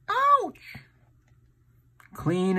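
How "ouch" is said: very high squeaky pained ouch; mock ouch. Uncle voicing the hurt bear, very high squeal.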